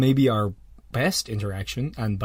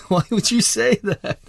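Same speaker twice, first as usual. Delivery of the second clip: high, drawn-out incredulous question; baffled disbelief; 9 semitones higher than usual. Acted. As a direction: ask it slowly and high, utterly baffled. Baffled reply to self-declared bad people.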